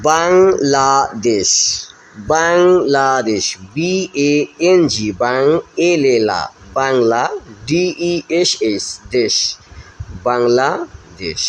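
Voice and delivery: বাংলাদেশ slowed, spelled letter by letter, then বাং, লা, দেশ; broken-down pronunciation. Shown as a demonstration. Teacher spelling বাংলাদেশ for pupils, syllable by syllable. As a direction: build বাংলাদেশ syllable by syllable for the class. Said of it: "আমরা এইভাবে ভেঙে ভেঙে উচ্চারণ করব".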